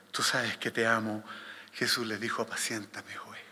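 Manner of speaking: low, soft Gospel reading; solemn scripture reading. A narrator's reading. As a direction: read it hushed and low. Reads the Gospel passage aloud. (lectura bíblica solemne, grave y suave)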